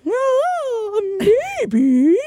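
a playful seductive coo; seduction voice. Real, not acted. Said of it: "my seduction voice is coming out".

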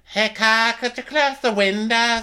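high, fast pretend woman; high mock Natasha voice. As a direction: pitch it way up, playful. Playing Natasha in the bit.

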